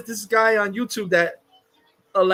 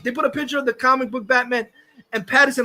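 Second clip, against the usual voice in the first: ordinary talk, nothing marked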